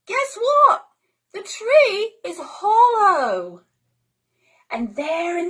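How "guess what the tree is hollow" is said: put-on high, loud excited child call; excited high call from up a tree; Pippi calling down. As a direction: whoop down from the treetop. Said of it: "she called down".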